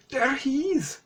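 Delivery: high-pitched imitation of a girl; put-on high little girl pointing out; girl's voice. Girl pointing at the clown, high mock voice. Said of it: "says the girl pointing at the clone".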